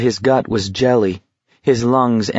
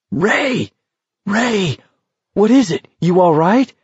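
high, urgent holler; screaming over a roar; 9 semitones higher than usual. A narrator's reading. McVries screams to Ray over the roaring crowd, high urgent holler. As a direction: call out to him in alarm, pitched well up. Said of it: "screaming at him over the roar".

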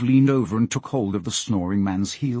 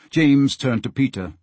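ordinary delivery, nothing marked

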